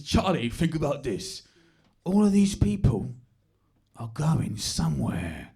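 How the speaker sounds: slow, weighty dad impersonation; mock-philosophical East London dad; would-be profound dad. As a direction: say it like a deep observation about life. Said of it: "things that he thinks are deep observations".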